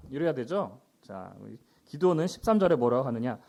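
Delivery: normal talking voice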